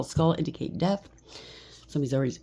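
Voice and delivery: ordinary talk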